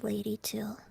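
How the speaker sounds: unvoiced whisper; whispered wish. Real, not acted. Whispering a wish for a new member. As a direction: breathe the wish secretly.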